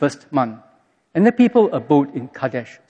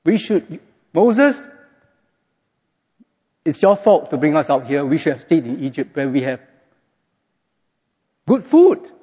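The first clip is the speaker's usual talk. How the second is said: blaming Moses. Retold or imitated. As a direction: pout and whine the blame. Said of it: "Blaming Moses".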